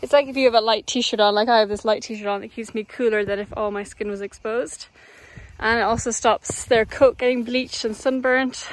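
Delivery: normal talking voice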